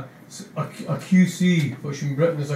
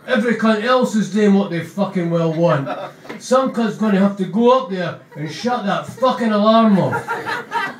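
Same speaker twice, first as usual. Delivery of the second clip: loud, higher hard-man rant breaking into laughter; Begbie ranting; 5 semitones higher, 5 dB louder than usual. Acted. Begbie ordering someone to shut the alarm, loud. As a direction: demand it, cracking up. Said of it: "he looks at Keysbo and goes".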